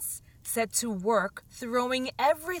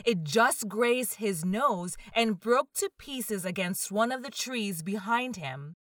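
plain reading voice

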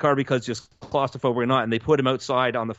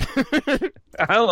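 slow high echo; 9 semitones higher, much slower than usual. Acted. Echoing the slow high take.

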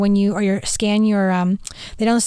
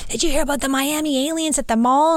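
mock excited husband, pitched high; imitating husband; 4 semitones higher than usual. Husband on the phone asking her, high mock voice. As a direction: play Chad breathless on the phone.

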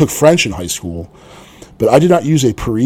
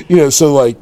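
ordinary talk

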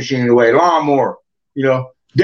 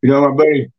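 usual speaking voice

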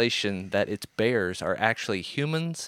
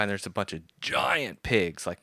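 usual speaking voice

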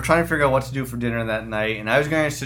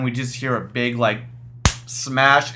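normal talking voice

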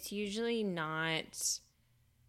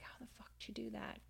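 fast, hushed whisper of disbelief; retold stunned whisper; 6 semitones higher, 15 dB quieter, much faster than usual. Aphrodite discovers the sorted grain, stunned whisper. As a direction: whisper it quickly, acting dumbfounded.

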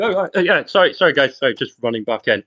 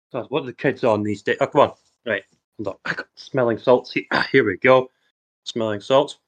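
ordinary delivery

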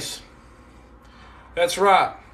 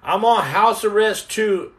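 ordinary delivery

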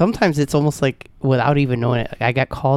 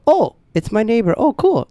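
much higher, breezy change of heart; sudden friendly relief; 8 semitones higher than usual. Retold or imitated. Driver recognizing the neighbor's car, suddenly friendly. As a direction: drop the anger for an instant cheery acceptance.

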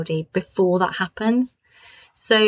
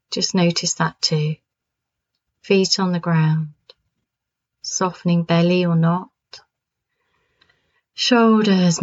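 calm grounding exercise; 2 semitones lower than usual. Acted.

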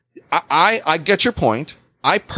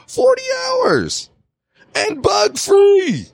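forty hours and bug free stretched out much higher and slow; exasperated emphasis; slower than usual. Real, not acted. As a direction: drag it out, incredulous.

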